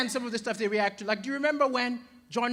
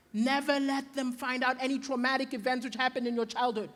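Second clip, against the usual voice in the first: ordinary talk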